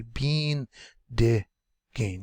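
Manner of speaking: "bean, de, gain" with a pause at each break; syllable by syllable. Shown as a demonstration. Teacher showing where syllables break, said piece by piece. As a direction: separate "bean", "de" and "gain" cleanly. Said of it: "so the syllable breaks here".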